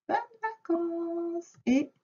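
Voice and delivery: high, slow, drawn-out goodbye; singsong farewell. Real, not acted. Teacher wishing a pupil happy holidays, singsong farewell. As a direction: stretch the sign-off out in a high singsong.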